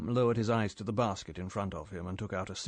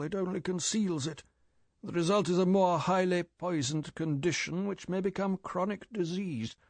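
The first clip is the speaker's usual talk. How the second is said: put-on severe old chief lecturing, higher; raised, lecturing boss voice; 6 semitones higher than usual. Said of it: "M said severely".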